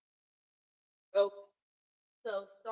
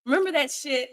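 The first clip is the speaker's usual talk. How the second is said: loud exclamation; 5 semitones higher, 17 dB louder than usual. Real, not acted.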